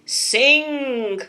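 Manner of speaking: loud giant order; giant's command. Acted. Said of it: "said the giant".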